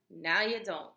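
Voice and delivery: usual speaking voice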